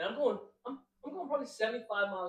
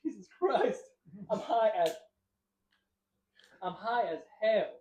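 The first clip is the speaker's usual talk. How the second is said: mock exasperation; 4 semitones higher, 5 dB louder, slower than usual. Realizing how high he is.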